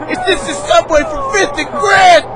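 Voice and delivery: strained, high-pitched demand for an answer; exasperated shout. Acted. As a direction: yell the question, fed up. Asking again, fed up.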